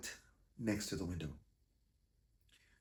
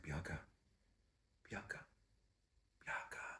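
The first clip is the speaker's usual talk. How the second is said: hushed calling; 5 semitones lower, 11 dB quieter than usual. Retold or imitated. Gently waking her at night.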